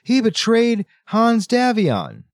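high, drawn-out mock accusation; imitated outraged yell, pitched high without full volume; retold yell. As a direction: cry it out like an accuser in a crowd. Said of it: "He hears someone yell".

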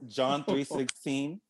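slow, solemn scripture quote; preacher voice. Acted. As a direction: intone it like a preacher. Mock preaching a Bible verse.

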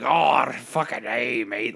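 slow, put-on Scottish accent; a lightly done Scottish accent; mock Scottish accent. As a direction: imitate a Scottish bloke at half speed. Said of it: "Scottish for sure".

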